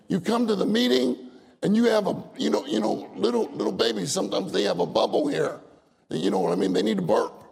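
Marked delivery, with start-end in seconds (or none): none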